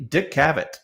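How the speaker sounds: usual speaking voice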